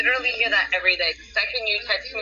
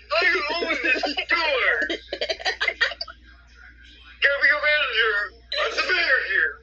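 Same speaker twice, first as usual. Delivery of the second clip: mock Karen; 7 semitones higher than usual. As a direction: demand the manager, hammed up. Acted demanding Karen.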